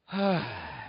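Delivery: long sigh falling away; big old sigh. Shown as a demonstration. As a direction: let it all out in one sigh. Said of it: "just a big old sigh".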